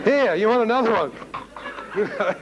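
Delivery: lightly voiced brother, slow and amused; mocking brother. Brother in the bar offering another drink, slow and teasing. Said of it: "and he's going".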